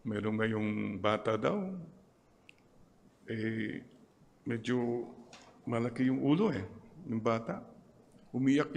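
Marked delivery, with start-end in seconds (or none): none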